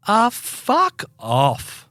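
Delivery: high, long-drawn mock outburst; retold losing it. Dog walker cutting off the neighbour, retold exasperated outburst. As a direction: stretch out an exasperated dismissal. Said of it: "and I just lost it".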